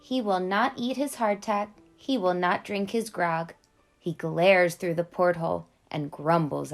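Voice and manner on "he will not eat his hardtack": usual speaking voice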